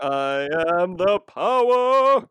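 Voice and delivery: slow, loud, drawn-out heroic proclamation; hammed-up superhero shout, stretching the last word; He-Man battle cry. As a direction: bellow it like a cartoon hero powering up. Mock He-Man power-up cry.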